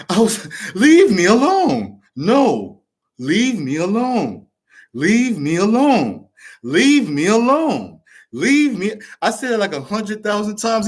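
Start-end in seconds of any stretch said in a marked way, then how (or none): 0.7-8.9 slow, swooping mock plea on repeat; exasperated plea. Son repeating himself to his father on the phone, exasperated plea. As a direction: drag it out like a stubborn broken record.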